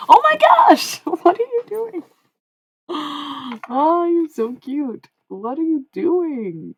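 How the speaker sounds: high, loud gushing squeal; delighted cooing. Real, not acted. Streamer squealing at a cute gift. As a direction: coo over something adorable.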